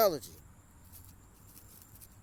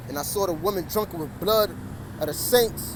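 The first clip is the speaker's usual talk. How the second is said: raised voice over the traffic, pitch climbing; preaching louder over the cars; louder street preaching; 2 semitones higher, 5 dB louder than usual. Real, not acted. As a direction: call it out louder so passers-by hear. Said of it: "so I gotta speak a little louder".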